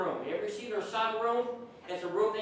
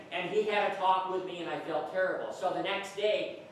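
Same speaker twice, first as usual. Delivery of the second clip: usual speaking voice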